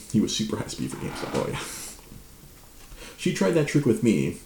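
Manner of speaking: ordinary talk, nothing marked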